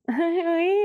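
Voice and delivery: high, put-on coy little "oui"; prissy fake voice. Picking up his call in her fake prissy voice. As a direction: answer like an affected, prim girl. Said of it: "je fais ma mijaurée".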